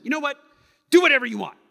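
high, loud fed-up snap; loud, exasperated spouse; frustrated. Acted. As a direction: throw up your hands at a spouse. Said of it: "We're communicating frustration".

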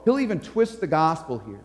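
ordinary talk, nothing marked

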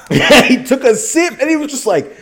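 high laughing retelling; can't stop laughing while telling it; giggling through the story. Real, not acted. Laughing through retold quote.

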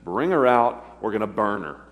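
loud, blunt order to burn her; harsh command. Retold or imitated. Judah ordering Tamar brought out to be burned, a loud, harsh command. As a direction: bark it out as Judah condemning Tamar. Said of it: "His response".

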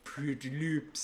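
put-on drawn-out Norwegian accent, doubtful; peeved, skeptical mock-Norwegian. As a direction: imitate a dubious Norwegian character. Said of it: "a skeptical Norwegian accent".

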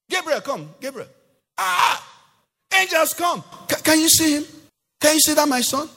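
high, loud thrilled call to the angels; God calling Gabriel, high and excited. Retold or imitated. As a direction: shout for the angels to come look.

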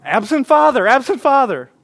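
loud high anguished shout; yelling out. Retold or imitated. Imagined shamed person yelling their pain, loud high shout. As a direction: cry it out like a leper's warning. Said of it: "you had to yell out your greatest pain".

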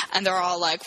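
ordinary talk, nothing marked

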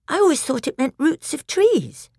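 put-on high, innocent little girl. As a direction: say it like a small wondering child. Lucy speaks, young girl.

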